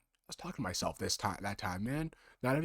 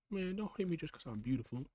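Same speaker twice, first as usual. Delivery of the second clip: higher, smug sing-song; cocky reply; 7 semitones higher than usual. Acted. Other self brushing off the insult, smug. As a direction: toss it off like a vain diva. Quoting cocky catchphrase back.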